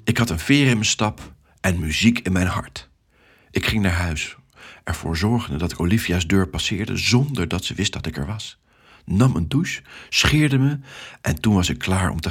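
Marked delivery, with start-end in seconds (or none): none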